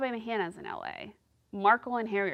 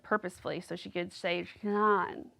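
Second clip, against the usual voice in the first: usual speaking voice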